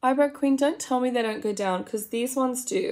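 ordinary talk, nothing marked

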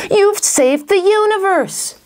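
high, slow, elated proclamation; triumphant praise. Acted. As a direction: celebrate the boy hero. Sidekick congratulates the boy.

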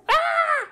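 loud high yelp; sudden shriek. Real, not acted. Owner's sudden shriek that scared the bird. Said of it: "didn't mean to scare you".

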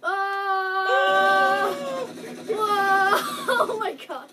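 high, loud drawn-out exclamations with laughter; shocked yelling. Real, not acted. Player caught in chaotic fight, shocked yelling. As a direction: yell in amazement, breaking into a laugh.

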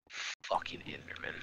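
low, slow whisper; whispered curse. Real, not acted. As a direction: hiss it under your breath. Muttered curse.

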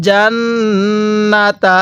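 slow জান্নাতা with a long hummed double n; gunnah nasal hold. Shown as a demonstration. Teacher shows learners how to read, nasal held জান্নাতা. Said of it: "এরকমভাবে শিখব".

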